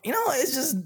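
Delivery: hammed-up tearful sigh; mock crying. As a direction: fake sob, then a sigh. Mock despair after joke.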